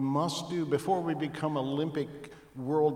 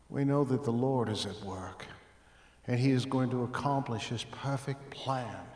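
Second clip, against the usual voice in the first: usual speaking voice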